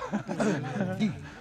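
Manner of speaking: short laugh after a shameful admission; bitter laugh. Acted. Man admits his wife paid him to leave, bitter laugh. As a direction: laugh at your own humiliation.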